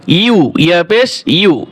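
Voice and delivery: ordinary delivery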